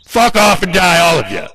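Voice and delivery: high, full-throat scream of rage; furious shriek telling them all to die. Real, not acted. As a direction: scream it at the top of your lungs. Peak of the cursing rant.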